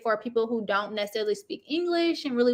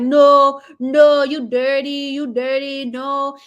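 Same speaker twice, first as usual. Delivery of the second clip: loud mock shout of the husband; hammed-up furious husband; mock angry yell; 8 dB louder, slower than usual. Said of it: "He like".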